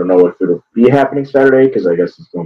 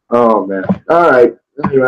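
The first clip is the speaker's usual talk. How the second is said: usual speaking voice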